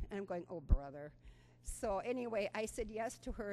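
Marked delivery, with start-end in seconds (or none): 0.5-1.1 mock exasperated. Her reaction to running request.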